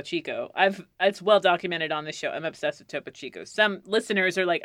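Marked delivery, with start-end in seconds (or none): none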